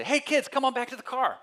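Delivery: put-on high, loud call to the kids; parents calling the kids. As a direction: call them back to the car, bright and loud. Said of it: "they'd say".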